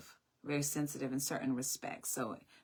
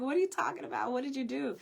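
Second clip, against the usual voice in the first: high, baffled mom's voice; puzzled mother; 10 semitones higher, faster than usual. Retold or imitated. Mother asking her daughter what she did, high and puzzled. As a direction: voice the mother's confused questioning.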